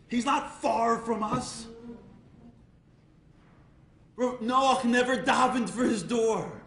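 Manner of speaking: slow, loud, building sermon; a rabbi urging the crowd to find God inside, impassioned; impassioned preaching. Acted. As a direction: drive the point home.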